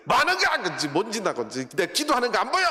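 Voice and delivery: sharp, loud and high, snapping at him; retold furious shout at the sweeping youth; angry outburst. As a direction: yell at the one raising dust. Deaconess snaps during prayer.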